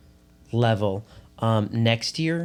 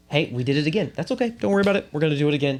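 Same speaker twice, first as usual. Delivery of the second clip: encouraging teacher; 4 semitones higher, 3 dB louder, much faster than usual. Pretend. Teacher coaxing kids to redo entry.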